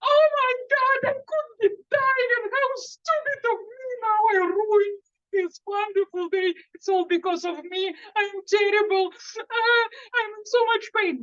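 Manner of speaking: hammed-up high anxious self-pity; panicked inner voice. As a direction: mimic the spiraling panic in your head. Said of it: "they start thinking".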